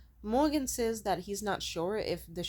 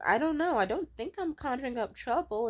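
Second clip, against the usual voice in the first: high-pitched, wavering past-self voice; self-doubting, pitched up; mock unsure protest; 6 semitones higher, 3 dB louder than usual. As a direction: act out doubting your own worries. Past self argues with intuition.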